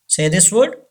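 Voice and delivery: normal talking voice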